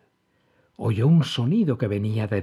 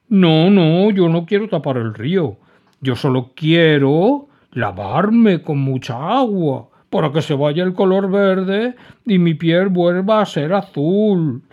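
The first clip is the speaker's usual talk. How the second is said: whiny refusal; 8 semitones higher, 8 dB louder than usual. A narrator's reading. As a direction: insist through tears. Dragon explains crying.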